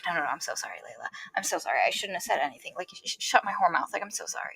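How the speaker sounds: low, hushed mock contrition; retold grovelling apology. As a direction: apologise under your breath, imitating a sorry boyfriend.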